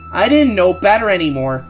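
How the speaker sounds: ordinary delivery, nothing marked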